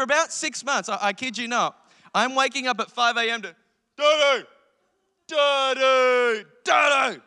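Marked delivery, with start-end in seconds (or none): 4.0-7.2 imitated toddler bellowing for dad, high and loud; angry toddler yell. Toddler son waking dad at five A M, imitated angry yell. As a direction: yell it like an angry small child demanding a parent. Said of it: "like, angry, deep".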